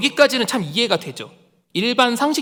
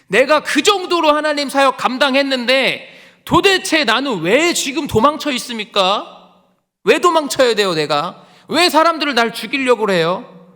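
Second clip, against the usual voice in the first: loud, high-pitched remonstrance; acted outraged appeal, forceful and raised; indignant protest; 8 semitones higher, 8 dB louder than usual. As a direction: cry out to God demanding to know why. Acted Elijah protesting to God.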